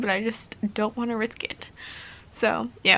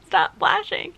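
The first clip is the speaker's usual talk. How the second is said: high, louder playful telling-off; higher and louder, playfully bossy; mock scolding; 9 semitones higher, 4 dB louder, slower than usual. Real, not acted. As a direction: scold the blinking light like a pet. Scolding her blinking camera light.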